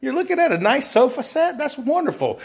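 high, delighted sales voice; approving salesperson. Acted. Salesperson hears customer wants sofa set, high and approving. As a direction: affirm the customer's choice, pitched well up.

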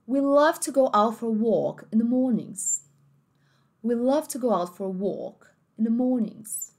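slow British English, no r in mornings; British accent. Shown as a demonstration. Teacher demonstrating British pronunciation to students. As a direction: put on the British accent, unhurried.

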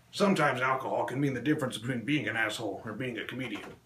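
ordinary delivery

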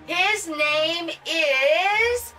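very slow, stretched lead-in; drawn-out suspense. Acted. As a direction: stretch it out before the reveal. Building suspense before the name.

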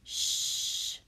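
a hushed "Sh" sound; teacher modeling the first sound of shouted. Shown as a demonstration. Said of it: "I'm going to sound it out".

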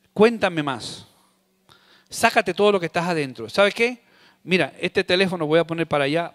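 soft, low caring voice; husband soothing his upset wife, tender. Retold or imitated. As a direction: comfort her gently, listening. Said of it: "opción número uno".

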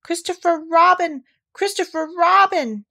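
high, loud calls for help; urgent high-voiced shout; crying out. A narrator's reading. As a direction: cry the name in relief and appeal. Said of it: "cried Piglet".

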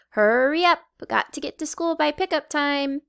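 calling out. A narrator's reading. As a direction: hurry them along loudly. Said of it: "he called".

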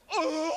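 loud guttural cry; primal moan. Retold or imitated. Showing the weird noise from the psyche, loud cry. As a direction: groan from deep in the gut. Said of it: "a weird noise from deep".

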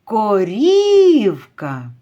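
корівка called loud, middle І held long; calling, stressed І. Shown as a demonstration. Teacher demonstrates stressed І, calling корівка with it stretched. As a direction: slowly call корівка, leaning on the І. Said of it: "Тут наголошений звук І".

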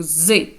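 "з" as a sustained buzz; voiced z sound. Shown as a demonstration. Teacher naming the sound esse makes between vowels, voiced "з". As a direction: say "з" fully voiced. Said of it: "читається як звук".

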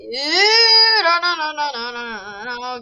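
loud panicked cry fading down. Real, not acted. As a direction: panic as the guard closes in. Guard spots the player.

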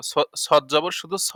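স said short and heavy, not drawn out as সা. Shown as a demonstration. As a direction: say স, never সা. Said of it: "সা না".

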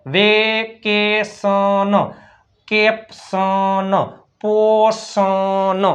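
each word drawn out slowly for learners; slow spelling drill. Shown as a demonstration. Teacher slowly sounding out words for students. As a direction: stretch every syllable so students follow. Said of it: "સીનો ક".

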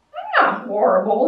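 loud, offended protest; indignant bear. A narrator's reading. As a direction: bear defending himself, loud and affronted. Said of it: "Bear was indignant".

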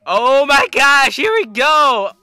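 high, loud over-the-top hype; hammed-up thrilled gamer; screaming YouTuber impression. Shown as a demonstration. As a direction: scream with excitement about everything. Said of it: "He screams".

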